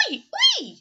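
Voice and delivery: high, loud happy oi; happy agreement. Acted. Missus Poodle agreeing with Missus Bulldog, a high happy cheer. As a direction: cheer it out gladly. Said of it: "agreed happily".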